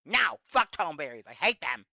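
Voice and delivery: loud angry outburst. Real, not acted. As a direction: yell it in pure frustration. Cursing the enemies in frustration.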